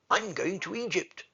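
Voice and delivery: high, eager cry; cried out. Acted. The swallow cries to the sailors, who do not mind. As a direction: call it out to the whole harbour. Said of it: "cried the swallow".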